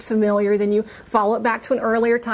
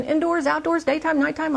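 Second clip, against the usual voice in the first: ordinary talk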